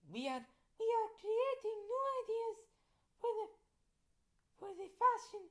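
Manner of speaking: put-on very high-pitched skeleton girl voice. Skeleton girl explains new fashion ideas, high character voice. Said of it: "the skeleton girl said".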